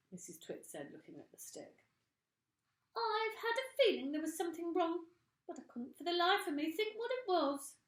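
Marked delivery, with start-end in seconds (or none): 3.0-7.7 put-on high, loud fretting old woman's voice; fretful old woman. A narrator's reading. Mrs Twit puzzling over her stick in a high fretful voice. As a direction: fuss in a high crone voice.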